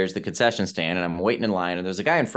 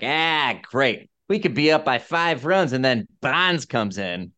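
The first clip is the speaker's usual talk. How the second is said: put-on Boston accent; 4 semitones higher than usual. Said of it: "heavy Boston accents".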